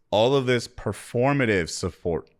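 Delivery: normal talking voice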